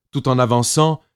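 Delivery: usual speaking voice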